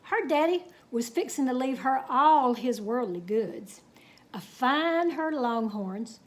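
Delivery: unhurried Texas tall-tale telling; story narration. A narrator's reading. Reading a cowboy tale to children, storytelling. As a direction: spin the yarn for the kids.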